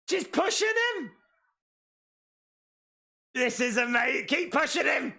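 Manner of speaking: high, real excited shouts; excited shouting. Real, not acted. Fan cheering Camille's surprise run-in, excited shouting. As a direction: cheer her on at the top of your voice.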